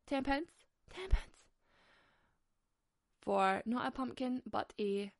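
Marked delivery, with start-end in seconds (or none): 0.1-0.5 rising, incredulous ten pence?; disbelieving question. Real, not acted. Mocking the supermarket price, disbelieving rise. Outrage at mouldy pumpkin price. 0.9-1.2 Ten pence? shrieked much higher and louder; outraged disbelief. Real, not acted. Ranting at the supermarket, shrill outraged repeat. Outrage at mouldy pumpkin price.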